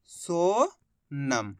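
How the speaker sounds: Sonam stretched very slowly, low and soft; Sonam said very slowly and softly as a final reveal; drawn out word. Shown as a demonstration. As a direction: draw out Sonam, every sound long. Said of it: "पहचान करो".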